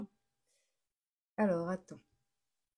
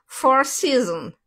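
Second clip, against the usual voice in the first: loud, drawn-out, higher English accent; exaggerated English accent; 5 semitones higher, 10 dB louder, much slower than usual. Shown as a demonstration. Streamer replays the name for a viewer mocking her, exaggerated English accent. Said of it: "de mon accent anglais".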